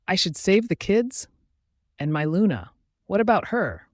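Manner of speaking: taken aback, pitch lifting on questions; incredulous questioning. A narrator's reading. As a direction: question it in mild indignation. Challenged to abandon his Luna.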